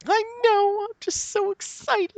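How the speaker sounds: very high, slow squeal; gushing excitement. Real, not acted. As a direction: squeal it out giddy. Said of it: "I'm getting excited".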